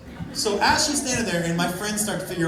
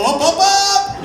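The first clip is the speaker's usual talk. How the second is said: stepping up higher and louder on each word; climbing pitch; 9 semitones higher, 6 dB louder, slower than usual. Acted. Mimes business climbing with rising pitch.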